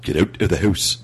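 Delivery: put-on joking Canadian accent. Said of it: "you can make fun of my Canadian accent".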